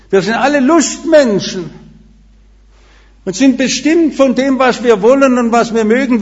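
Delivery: emphatic sermon delivery; preaching at full force. Acted. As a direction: rouse the congregation from the pulpit. Sermon climax on new birth.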